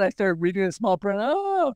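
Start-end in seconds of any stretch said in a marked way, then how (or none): 1.2-1.7 surprised oh. Retold or imitated. Reaction to reading small print.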